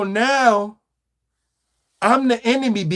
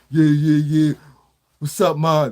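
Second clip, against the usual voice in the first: lightly voiced smooth talker; 5 semitones lower than usual. Imitating a smooth pickup line.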